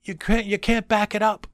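high, loud insistence; passionate professor. Retold or imitated. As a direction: drive the point home to the class. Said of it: "He said".

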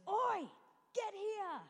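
high yell at the thieves; mock yell across the street; shouting. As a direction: call out sharply to stop them. Uncle yells at the robbers.

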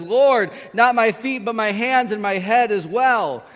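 high, loud, over-eager plea; passionate. Acted. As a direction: blurt it out with full zeal. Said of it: "passionate Peter goes to the other extreme".

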